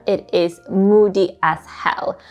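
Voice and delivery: usual speaking voice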